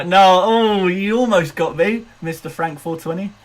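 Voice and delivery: surprised. Real, not acted. Caught by ice wallow trick.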